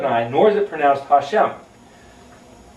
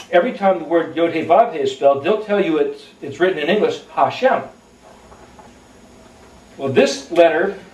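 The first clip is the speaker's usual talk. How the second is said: normal talking voice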